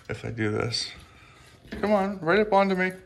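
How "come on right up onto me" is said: high-pitched pet coaxing; sing-song high encouragement. Real, not acted. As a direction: lure a small animal up with a high little voice. Coaxing lizard to climb up.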